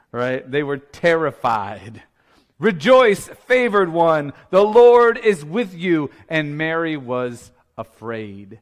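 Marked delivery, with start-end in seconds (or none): 2.6-6.1 loud, raised herald's announcement; angelic proclamation. Acted. Preacher re-enacting Gabriel greeting Mary, loud proclamation. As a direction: proclaim it grandly, stretching the last phrase.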